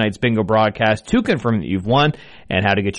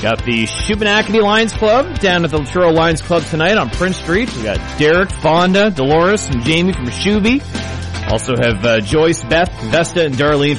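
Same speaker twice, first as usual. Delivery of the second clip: radio announcer; 5 semitones higher than usual. Acted. Radio host welcome.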